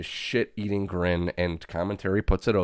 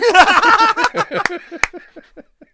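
loud high cackle; 13 dB louder than usual. Real, not acted. Laugh after taunt.

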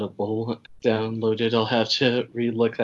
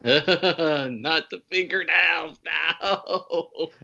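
slow, laughing mock shout of horror; laughing mock protest; 6 semitones higher, much slower than usual. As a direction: cry out through laughter in pretend dread. Horror plot point, laughing protest.

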